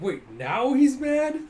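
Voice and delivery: high, loud, drawn-out incredulous question; mock disbelief. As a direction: stretch it out in baffled surprise. Acted baffled reaction.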